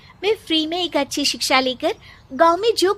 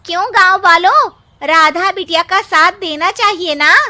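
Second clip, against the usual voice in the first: loud, raised rallying call to the whole village; calling out to the crowd; 4 semitones higher, 7 dB louder than usual. Acted. Woman calling out loudly to the villagers to support Radha. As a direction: rouse the villagers to back Radha.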